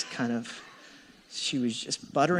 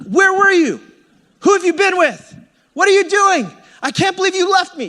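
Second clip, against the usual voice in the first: much higher, much louder accusing shout; hammed-up jealous boyfriend yelling; imagined furious rant; 12 dB louder than usual. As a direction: blast her with the questions. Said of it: "I can't wait to let her have".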